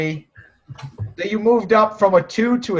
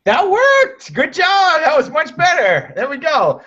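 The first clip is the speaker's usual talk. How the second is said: high, loud excited praise; delighted praise; 10 semitones higher, 4 dB louder, slower than usual. Real, not acted. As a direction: cheer the trainee on.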